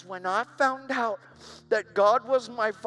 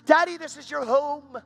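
put-on high, loud, drawn-out child's plea; pleading little daughter; 7 semitones higher, 5 dB louder than usual. Little girl insisting to her dad, pleading.

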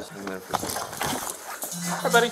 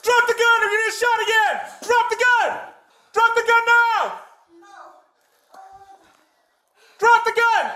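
urgent police yell, much higher; shouting commands; 8 semitones higher than usual. Real, not acted. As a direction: bark the order at full force. Police shouting at armed suspect.

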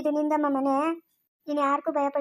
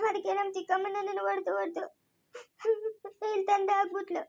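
much softer, voice breaking into tears; crying; 6 dB quieter than usual. Acted. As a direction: sob it out quietly. Girl tells of being beaten. (ಅಳುತ್ತಾ ಮೆಲುದನಿಯಲ್ಲಿ)

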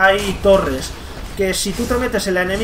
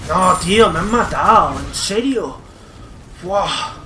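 frustrated outburst; 3 dB louder, slower than usual. Real, not acted. Just died in game.

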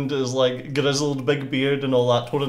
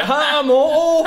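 put-on higher, louder Scottish-accented yell, slow; mock Scottish shout; 13 semitones higher, 7 dB louder, slower than usual. Husband mocks the Scottish lead, loud Scottish accent. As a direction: bellow the name like a rowdy Scotsman. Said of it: "Bloody Scottish guy".

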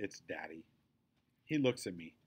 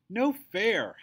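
much higher, louder howling protest; 12 semitones higher, 10 dB louder than usual. A narrator's reading. Second bully howling at his mother, loud howl. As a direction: howl it in outrage. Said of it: "howls bully head number two".

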